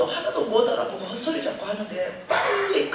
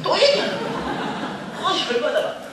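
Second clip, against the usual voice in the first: much higher, laughing disbelief; exasperated high whine; 8 semitones higher, 3 dB louder than usual. Real, not acted. Speaker retelling mother repeating herself, high exasperated laugh. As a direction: squeal it out, fed up and laughing.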